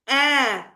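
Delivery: teacher isolating ए for students; isolated e sound. Shown as a demonstration. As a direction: sound out ए to show how the word starts. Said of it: "ए से शुरू हो रही है pronunciation".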